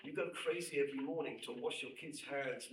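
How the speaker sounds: ordinary delivery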